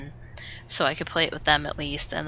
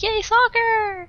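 high, slow, sarcastic "Yay, soccer!" with each word drawn out; mocking cheer; much slower than usual. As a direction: stretch out the family's fake cheer. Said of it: "my whole family is like".

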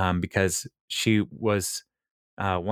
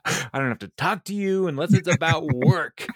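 imitating Ethel; 7 semitones higher than usual. Ethel's line quoted a second time.

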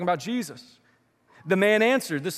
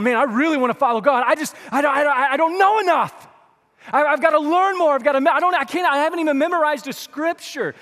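higher, louder, fretting believer voice; a hint of a panicky congregant; anxious believer; 7 semitones higher, 7 dB louder than usual. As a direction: play a flustered worried churchgoer.

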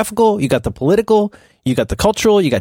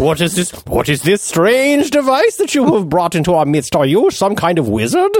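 shakespearean english; 2 semitones higher than usual. Pretend. Medieval peasant amazed at device.

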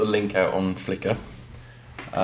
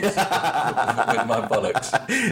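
laughing; 3 semitones higher, much slower than usual. Real, not acted. Laughing through punchline.

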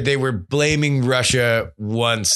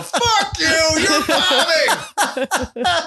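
high, laughing mock heckle; heckling inner voice; much slower than usual. Host voices a comic's inner heckler, high and laughing. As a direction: jeer at yourself as a laughing inner voice. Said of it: "every one of those last four voices".

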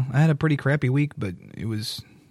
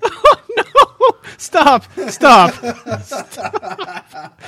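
loud laughing stop; laughing protest; 11 semitones higher, 10 dB louder, much slower than usual. Real, not acted. Friend cracking up at a bad pun, laughing loud. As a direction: beg him to stop through laughter.

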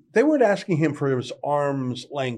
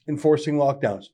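ordinary delivery

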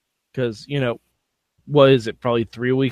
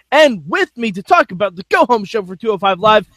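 ordinary talk, nothing marked

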